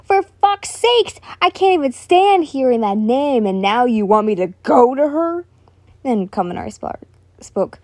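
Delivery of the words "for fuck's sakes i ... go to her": sneering. Acted. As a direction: sneer it out in disgust. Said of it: "Bakugo sneered".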